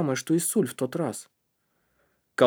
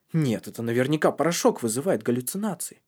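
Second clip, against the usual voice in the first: ordinary delivery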